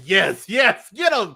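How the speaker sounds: high, loud cheer of "get him". Retold or imitated. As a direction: egg him on like a horror fan. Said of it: "I was like".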